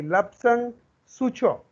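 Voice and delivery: Lapsang Souchong slow, in two separate words; pronunciation model. Shown as a demonstration. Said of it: "Please pronounce it with me". ("Lapsang Souchong" का धीमा, साफ़ उच्चारण)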